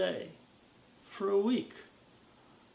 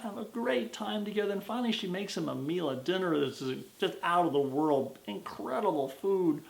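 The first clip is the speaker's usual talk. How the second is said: usual speaking voice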